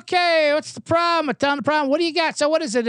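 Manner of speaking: lightly voiced, chipper urgent care doc; mock doctor. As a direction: play the careless clinic doctor. Comic quotes the urgent care doctor.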